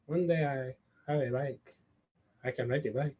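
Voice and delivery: put-on low, soft Spanish accent with halting pauses; awkward, quiet Spanish-accented voice; Pedro. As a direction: mumble shyly in a Spanish accent. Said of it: "I'm gonna do the accent".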